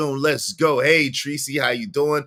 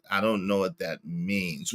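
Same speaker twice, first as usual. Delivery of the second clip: ordinary talk, nothing marked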